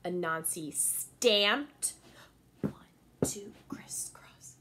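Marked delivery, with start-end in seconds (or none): 2.6-4.5 breathy hushed count; whispered count. A narrator's reading. Teacher counting Anansi's stamps in a whisper.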